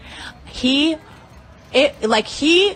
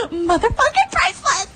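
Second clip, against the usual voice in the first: gleeful cry; 13 semitones higher than usual. Real, not acted. Gleeful climax of brag.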